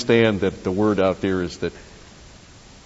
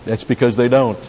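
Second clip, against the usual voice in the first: ordinary talk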